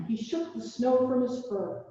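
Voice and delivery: ordinary delivery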